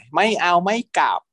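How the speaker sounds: high, whiny sulking voice; a bit of a pouting teen; sulky whine. Retold or imitated. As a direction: sulk like a spoiled kid. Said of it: "งอนงอแง".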